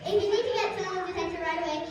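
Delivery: usual speaking voice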